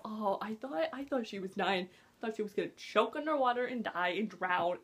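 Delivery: ordinary talk, nothing marked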